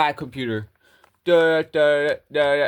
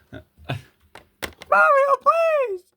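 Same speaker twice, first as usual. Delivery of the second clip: shrill falsetto plea, high and a bit louder; high pleading wife; 4 dB louder, slower than usual. Acted. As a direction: beg in a squeaky woman's voice.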